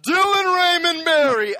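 angry parent shouting the full name, high, loud and slow; parent yelling full name. Retold or imitated. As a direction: bellow the kid's full name like a furious mom. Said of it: "have your parents ever said something like".

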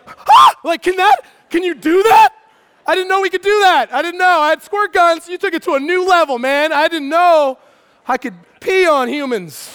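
put-on high, loud, disbelieving kid's panic; mock terror. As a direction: play your five-year-old self freaking out. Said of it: "I remember feeling terror".

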